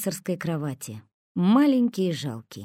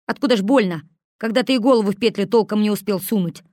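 scoffing; 3 semitones higher, 6 dB louder, faster than usual. A narrator's reading. Косой mocks Хмырь.

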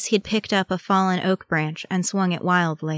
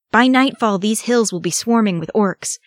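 raised, forceful comeback; urgent retort; 5 semitones higher, 7 dB louder than usual. A narrator's reading. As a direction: fire it back loud and high. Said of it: "Aragorn retorted".

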